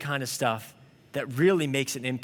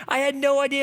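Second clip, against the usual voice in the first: high, louder, choked with emotion; tearful; 10 semitones higher, 5 dB louder than usual. Retold or imitated. The waitress overwhelmed by the tip, high and tearful. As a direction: blurt it out as tears come. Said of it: "And she's crying and weeping".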